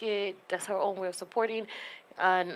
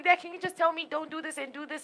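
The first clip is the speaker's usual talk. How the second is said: pleading with dad; 8 semitones higher than usual. Retold or imitated. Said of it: "I'm like".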